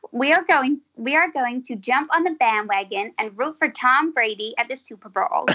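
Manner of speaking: best attempt at an American accent; careful American accent, working at the R's. Shown as a demonstration. British performer trying an American sentence for the host, American accent. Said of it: "In your best American accent".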